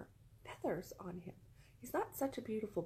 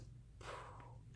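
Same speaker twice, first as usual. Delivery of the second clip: soft puff of air fizzling out; deflating sigh; 14 dB quieter than usual. Acted. Showing how the peak is over, deflating puff. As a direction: blow out a long, quiet breath. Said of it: "and then it goes".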